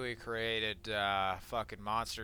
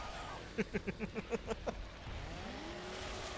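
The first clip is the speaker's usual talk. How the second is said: breathless laugh; 10 semitones higher, 12 dB quieter than usual. Real, not acted. After chainsaw kill.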